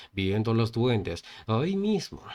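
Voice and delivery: ordinary delivery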